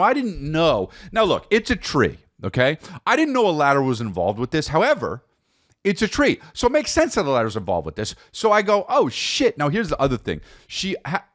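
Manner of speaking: ordinary delivery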